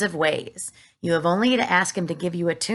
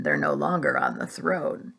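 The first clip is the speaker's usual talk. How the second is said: much lower gruff aside; deep fireman voice. A narrator's reading. Fireman remarking on the royal children. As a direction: grumble it dryly.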